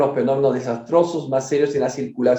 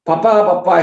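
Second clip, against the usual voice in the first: louder, higher tearful call to papa; crying out; 5 semitones higher, 6 dB louder than usual. Acted. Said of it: "exclamaban llorando".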